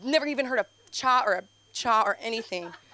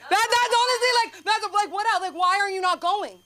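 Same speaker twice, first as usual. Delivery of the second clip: much higher, fed-up outburst; exasperated rant; 9 semitones higher than usual. Acted. Ranting to the crowd about a relative refusing therapy, high and exasperated. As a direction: throw up your hands in disbelief.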